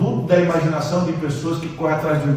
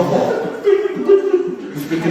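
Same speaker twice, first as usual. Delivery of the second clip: drawn out through a laugh, much higher; much slower than usual. Real, not acted. Preacher laughing to the church about his wife. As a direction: laugh as you say it. Laughs while telling the story.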